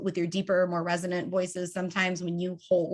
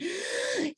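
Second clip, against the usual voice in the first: a high, sudden gasp when the air runs out; snatch a quick shallow breath; panicked inhale after talking too long; sharp gasp for air; 5 dB quieter than usual. Shown as a demonstration. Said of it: "take that deep breath in because you've run out of air".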